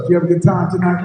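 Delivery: fast, loud, high hype call; shout to crowd. Real, not acted. Host hypes the live audience, shouting. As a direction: rouse the room.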